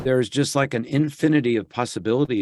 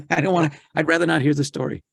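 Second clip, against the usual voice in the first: fast whiny complaint; 5 semitones higher, faster than usual. Retold or imitated. Continues the pained protest.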